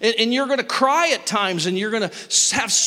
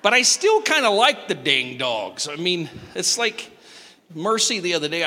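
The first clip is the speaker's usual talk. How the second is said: ordinary talk, nothing marked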